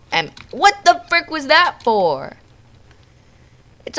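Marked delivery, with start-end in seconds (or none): 0.5-2.4 high, loud, drawn-out protest; indignant outburst. Real, not acted. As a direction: protest loudly at being attacked. Attacked in game.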